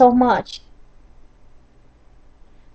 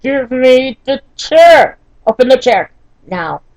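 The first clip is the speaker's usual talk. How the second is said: angry demand; 4 semitones higher, 5 dB louder than usual. Retold or imitated. Wife in pain ordering husband to bring the chair, angry demand. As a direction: imitate a desperate, exasperated demand.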